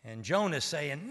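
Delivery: ordinary talk, nothing marked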